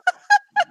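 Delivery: short squeaky burst of laughter; a quick giggle up high. Real, not acted. Laughing at own joke.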